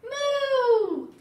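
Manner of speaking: high, loud, drawn-out moo; long playful storybook moo; baby's moo. Acted. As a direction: moo out like a delighted baby. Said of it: "Baby says".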